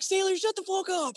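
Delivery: high mock snap; gunny silencing the complaining sailor. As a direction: cut him off like a gunny.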